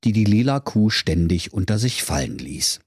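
plain reading voice

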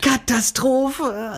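high, long drawn-out wail of a word; comic lament. Real, not acted. Storyteller on his embarrassment at the checkout, comic lament. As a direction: stretch it out in mock dismay.

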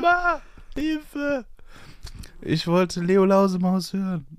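put-on high, slow wailing kid calling mom; screaming child. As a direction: play a kid crying for help, high. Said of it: "so ein schreiendes Kind".

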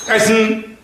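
high barked shout of Essen; drill sergeant shout. Retold or imitated. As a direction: bark it like a sergeant calling to dinner. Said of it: "Wie ein Feldwebel".

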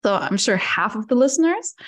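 ordinary talk

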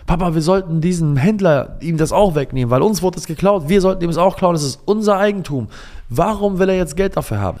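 put-on high, loud demanding child; a boy insisting it's unfair; indignant child. Son urges father to take back the saddle, loud indignant child.

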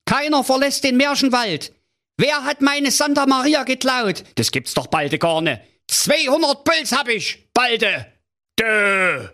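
very high, flustered comic king; a panicking king shouting orders; king losing his head over his missing daughter, high and agitated; flustered King Pilsner. Acted. King panics in fairy tale.

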